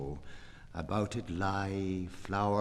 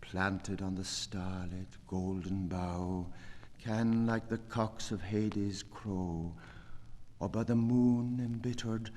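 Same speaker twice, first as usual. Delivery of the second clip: ordinary talk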